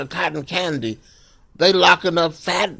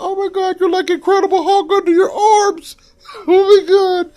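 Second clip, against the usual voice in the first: falsetto amazed squeal with laughter; high shocked squeal; 5 dB louder than usual. Acted. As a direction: squeal in disbelief. Retold shocked reaction to hair.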